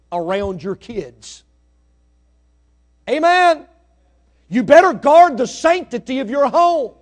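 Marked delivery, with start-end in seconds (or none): 3.1-6.9 loud, high, driving preacher's warning; full-force preaching. Acted. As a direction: hammer the warning home to the congregation.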